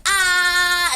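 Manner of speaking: high, blaring mock "ah"; wide-open loud ah. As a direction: belt it out as if opening wide. Told repeatedly to open wider.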